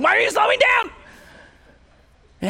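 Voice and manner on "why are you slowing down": shouts, much higher and louder; nervous husband shouting at his wife in traffic, much higher and louder; panicked shout. Retold or imitated. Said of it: "And I'm like".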